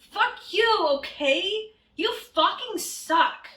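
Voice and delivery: loud, high, indignant outburst; angry rant. Acted. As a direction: yell it at the haters. Reading an author's rant.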